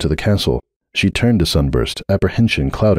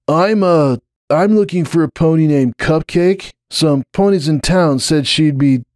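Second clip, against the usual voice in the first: put-on higher, nervous young stallion; nervous Hoops; 9 semitones higher than usual. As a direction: fumble it out nervously, pitched up.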